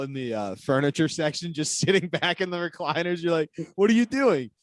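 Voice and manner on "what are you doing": mock dumb excuse. Mocking lazy workers' excuse.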